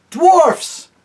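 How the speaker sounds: very high, loud call; a king calling the dwarfs back; crying out. Acted. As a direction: summon them sharply. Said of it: "cried Tyrion".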